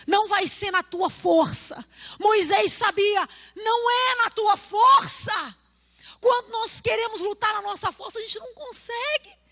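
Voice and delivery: high, shouted preaching; fiery preaching. Acted. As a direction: preach it out at full force. Preaching at full force.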